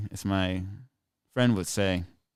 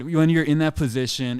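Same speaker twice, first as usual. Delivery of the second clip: ordinary talk, nothing marked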